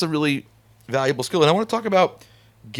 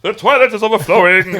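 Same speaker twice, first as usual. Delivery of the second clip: loud, high mock-opera voice; 8 semitones higher, 7 dB louder than usual. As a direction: declaim it like a hammed-up tenor. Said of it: "Does [name] talk like he sings?".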